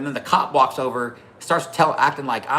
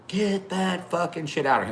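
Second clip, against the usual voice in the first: retold heckling; 7 semitones higher than usual. Pickup driver heckling the rider over his flag, mock irate. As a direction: imitate an irate stranger, pitched up.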